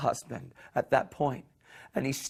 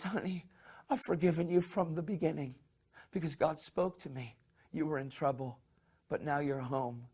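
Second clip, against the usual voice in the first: much softer, gentle and calm; tender forgiveness; 2 semitones higher, 9 dB quieter than usual. Retold or imitated. Husband forgives his wife at the door, soft and tender. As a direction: welcome her home tenderly.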